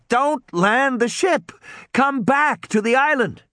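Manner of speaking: put-on high, slow, pleading boy's warning; boy urgently warning the ship captain, high and slow. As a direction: beg the captain to stop. Tim urgently warns the captain.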